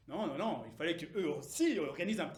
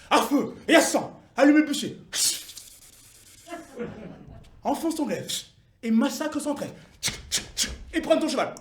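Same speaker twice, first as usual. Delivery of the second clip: loud, punchy war cries; forceful shouted commands in short bursts; battle exhortation; 3 semitones higher, 8 dB louder than usual. Acted. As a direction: urge the troops on to slaughter. Urging the fighters into battle.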